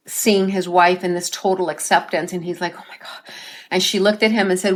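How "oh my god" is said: awed gasp. Retold or imitated. Awestruck father meets dead wife.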